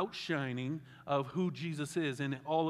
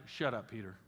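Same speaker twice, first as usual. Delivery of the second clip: low, stern mock God voice; God telling Peter off; 6 semitones lower, 4 dB quieter than usual. God silencing Peter on the mountain, low and stern. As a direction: drop low for a curt divine rebuke. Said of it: "God was like".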